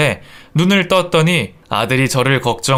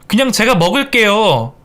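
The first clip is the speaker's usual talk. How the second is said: acted irritated outburst, raised pitch; sudden yell; 7 semitones higher than usual. Daughter-in-law refusing her mother-in-law's food, sudden yell. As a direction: cry out in annoyance, higher. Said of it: "며느리가 갑자기 소리를 지르는".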